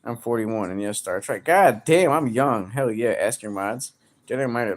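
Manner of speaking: ordinary talk, nothing marked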